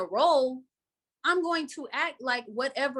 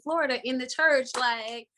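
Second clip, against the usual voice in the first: usual speaking voice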